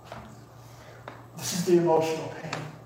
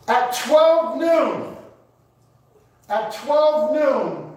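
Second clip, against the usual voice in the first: fervent preaching; 5 semitones higher than usual. Real, not acted. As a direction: hammer each phrase home to the congregation. Preacher builds at full force.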